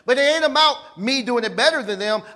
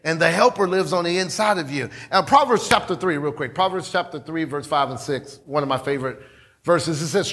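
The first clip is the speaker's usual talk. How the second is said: normal talking voice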